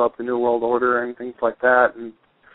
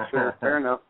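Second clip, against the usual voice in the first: ordinary talk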